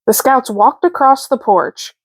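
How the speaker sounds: ordinary delivery, nothing marked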